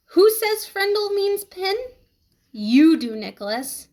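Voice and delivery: pointed, rising then dropping; teacher voice. A narrator's reading. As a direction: echo the teacher's challenge. Teacher's question echoed.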